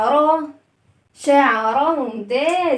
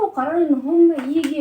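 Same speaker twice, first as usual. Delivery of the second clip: usual speaking voice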